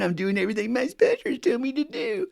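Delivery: high, prissy recital voice; high prissy voice mocking Mister Sobriety; mock goody-goody. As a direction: sneer it like a teacher's pet reciting. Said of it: "that image out there".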